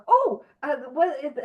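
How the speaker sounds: ordinary talk, nothing marked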